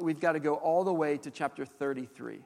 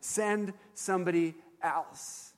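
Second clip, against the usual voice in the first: slow and deliberate, letting each word land; a measured echo of the plea; slow, weighty restatement; 2 semitones higher, slower than usual. Shown as a demonstration. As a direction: say it slowly, like quoting Moses. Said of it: "that's exactly what Moses is saying".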